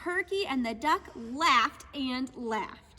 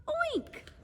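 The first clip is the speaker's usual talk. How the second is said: pig oink; 12 semitones higher than usual. Acted.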